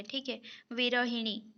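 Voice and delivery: normal talking voice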